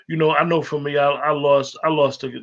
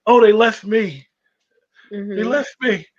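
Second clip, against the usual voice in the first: mock mourner; 6 semitones higher, 3 dB louder than usual. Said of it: "you know".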